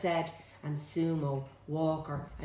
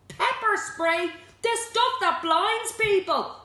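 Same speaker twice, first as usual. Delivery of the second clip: high, loud, drawn-out disbelief; slow, aghast outburst; clearly alarmed; 7 dB louder than usual. A narrator's reading. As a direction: cry it out in alarm. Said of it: "clearly alarmed".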